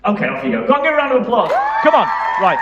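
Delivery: loud applause call. Real, not acted. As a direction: shout over the room for a round of applause. Calling for applause.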